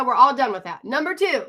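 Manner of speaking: ordinary delivery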